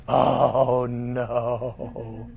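low, soft, slow mock lament; lightly hammed-up regret; mock dismay. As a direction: groan it like a ruined king. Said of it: "Nebuchadnezzar's like".

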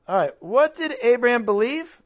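ordinary talk, nothing marked

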